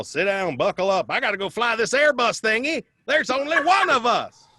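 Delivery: lightly hammed-up stewardess giving orders; imitating flight attendant. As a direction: bark orders like a frazzled stewardess. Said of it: "She's all".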